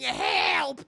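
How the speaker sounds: loud, drawn-out cry for help; mock screaming. Narrator acts Ellie freaking out, mock scream for help. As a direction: scream it out in hammed-up panic. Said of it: "starts screaming".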